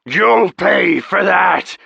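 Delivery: loud, slow, menacing growl of a threat; slow booming dragon threat; threatening dragon. Acted. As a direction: promise revenge like a wounded beast. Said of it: "the dragon threatened".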